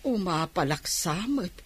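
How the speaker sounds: grouse quietly so no one hears; bitter muttering alone after the niece leaves; muttering to herself. Acted. Aunt grumbling alone after visit.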